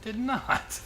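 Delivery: sharp high protest; indignant denial. Real, not acted. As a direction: squeak out the denial. Denying he cursed.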